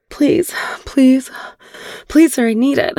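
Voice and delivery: high, gasped pleas; frantic, breathless begging. Acted. Woman pleading with her partner, frantic and out of breath. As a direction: beg between catches of breath, pitched well up. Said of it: "I was frantic and out of breath".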